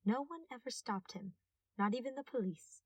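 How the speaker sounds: plain reading voice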